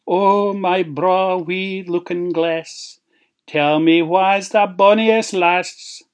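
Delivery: loud chanted rhyme; queen's incantation. Acted. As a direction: intone it grandly to a magic mirror. Queen chants to magic mirror.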